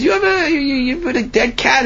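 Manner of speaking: high, sputtering cry of disgust; outraged accusation. Acted. Tenants accusing the man, crying out in disgust. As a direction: splutter the accusation in shock.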